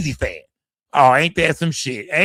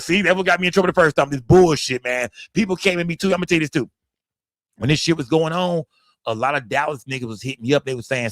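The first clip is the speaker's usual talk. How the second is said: ordinary talk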